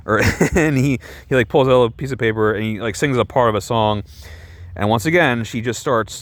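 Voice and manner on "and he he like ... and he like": ordinary talk, nothing marked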